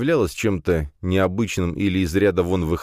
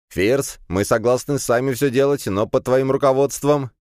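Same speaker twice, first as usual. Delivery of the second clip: higher, pleading bargain; calling through a door; 7 semitones higher than usual. A narrator's reading. Classmate offering to work under Firs, raised pleading call. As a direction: raise your voice and offer a deal.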